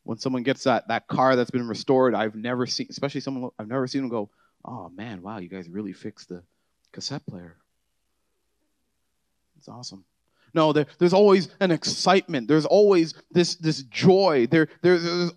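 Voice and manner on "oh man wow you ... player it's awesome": deadpan sarcastic praise; mock underwhelmed owner. Car owner deadpanning about the restored cassette player, mock bored. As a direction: lower and much softer, a bored fake wow.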